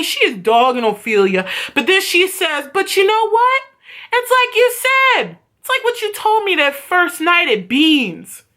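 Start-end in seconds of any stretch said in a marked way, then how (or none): 2.7-8.4 theatrical high-pitched retort; acted confrontation. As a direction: voice up high, setting up the blow. Acts Jules's cutting speech.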